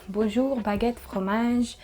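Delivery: playful put-on French accent, drawn out; mock French accent. Vlogger joking about her own French to viewers, mock French accent. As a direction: play at being French. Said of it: "not my best French accent".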